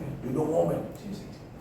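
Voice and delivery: ordinary delivery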